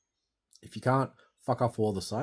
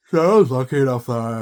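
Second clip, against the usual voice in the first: loud emphatic; 4 semitones higher, 16 dB louder than usual. Real, not acted. Emphatic retelling.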